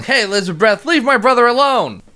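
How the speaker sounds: high-pitched, mild mock yell. Alex yelling to leave a brother alone, mild mock yell. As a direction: imitate a protective kid yelling, without much volume. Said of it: "Alex is yelling".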